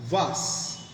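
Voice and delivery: "vas" with a long ah, British style; British pronunciation. Shown as a demonstration. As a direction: draw out an open ah in "vas". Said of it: "In British English, it is pronounced as". ("vas" को British ढंग से "वास" बोलें)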